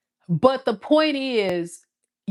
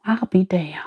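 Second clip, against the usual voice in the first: muttered whisper of disbelief. Pretend. The ex realizing Aquarius won't answer, whispering. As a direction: breathe it out in a whisper.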